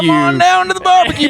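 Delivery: hammed-up Southern accent, pitched high. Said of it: "they were doing Southern accents".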